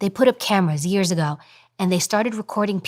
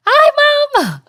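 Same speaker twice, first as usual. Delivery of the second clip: very high, loud squealed greeting; high-pitched girlish voice; 9 dB louder than usual. Shown as a demonstration. Daughter greeting her mom, exaggerated high squeal. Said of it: "she has to talk like this all the time".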